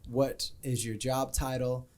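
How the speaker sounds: ordinary delivery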